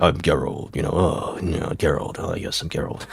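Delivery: low, hushed, brooding Geralt voice; a quiet, mopey take on Geralt; low, soft Geralt impression. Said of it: "my impression of Geralt".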